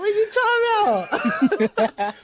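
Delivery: high laughing shout, slowed; cracking up at the wrong number; laughing outburst. Real, not acted. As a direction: burst out laughing in disbelief.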